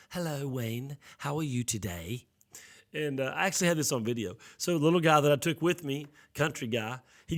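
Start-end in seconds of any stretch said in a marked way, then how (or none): 0.1-2.2 lightly imitated prim British English greeting; a polite British-taught Russian interpreter, softly; proper British English. Russian interpreter greeting the pastor in proper British English. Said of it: "she had a very proper".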